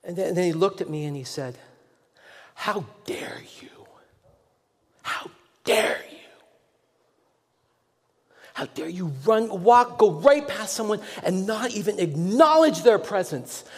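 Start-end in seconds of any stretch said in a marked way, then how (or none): 2.5-13.6 growing anger. Retold or imitated. Angry stranger scolding a pastor who passed him, whisper to shout. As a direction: build from a slow hushed hiss to a slow high furious shout. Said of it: "And he got more and more angry".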